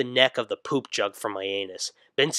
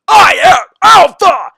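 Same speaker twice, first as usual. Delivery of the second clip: slow, shrieking, far higher and far louder; shouting; 17 dB louder, slower than usual. Acted. Son shouts at his mom while beating his chest. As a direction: bellow it like a gorilla. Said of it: "I continue to shout".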